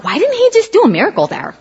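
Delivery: puzzled question. Retold or imitated. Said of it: "I'm like".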